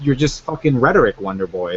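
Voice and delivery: usual speaking voice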